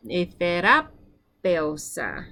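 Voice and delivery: etherapeusa sounded out slowly, syllable by syllable. Shown as a demonstration. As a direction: stretch out etherapeusa for the learner. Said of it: "I'm gonna say it one more time".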